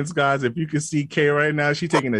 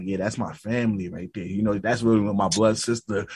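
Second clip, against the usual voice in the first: much lower, softer comeback; smug cool reply; 8 semitones lower, 5 dB quieter than usual. Retold or imitated. Imitating the guy's calm comeback, low and smug.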